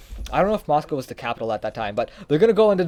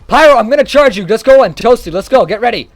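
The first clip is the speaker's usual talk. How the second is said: much higher and louder, urging on; excited rallying shout; 7 semitones higher, 11 dB louder than usual. Real, not acted. Medic urging teammates Pyro and Toasty to charge, excited shout. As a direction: pump the team up to charge in.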